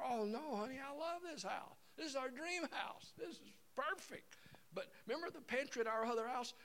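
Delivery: sweet wife. Retold or imitated. Wife reassuring husband she loves the house, high and sweet.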